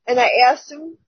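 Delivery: ordinary talk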